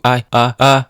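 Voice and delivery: mock about-to-sneeze stammer; pretend pre-sneeze. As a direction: act out a sneeze coming on. Building up to a sneeze.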